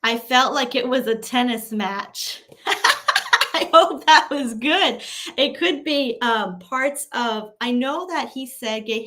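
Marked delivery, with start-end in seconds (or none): 2.7-3.5 high laugh. Real, not acted. Laughs after tennis-match remark.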